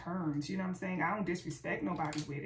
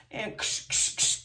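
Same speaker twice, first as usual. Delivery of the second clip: unvoiced spray-bottle ksh sounds; spray hiss noises; 5 dB louder, slower than usual. Vlogger imitates spritzing her Love Ritual spray. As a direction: mimic spritzing the spray on brushes.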